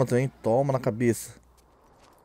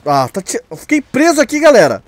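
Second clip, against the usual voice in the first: frustrated shout; 7 semitones higher, 11 dB louder than usual. Real, not acted. Stuck in game, loud.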